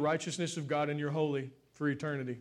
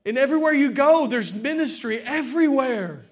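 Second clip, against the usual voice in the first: loud, raised preaching voice; 7 semitones higher, 7 dB louder than usual. Real, not acted. Preacher urging church to love others, impassioned preaching. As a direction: preach it out with rising fervour.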